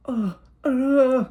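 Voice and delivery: high, frightened stammer; scared kid stammer. Retold or imitated. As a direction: caught out and stammering. Scared kid caught by father.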